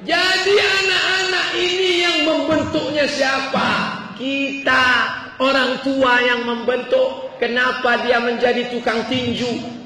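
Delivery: high, forceful preacher's questioning; preaching. Real, not acted. Preaches rhetorical questions at full force. (ceramah lantang dengan nada tinggi)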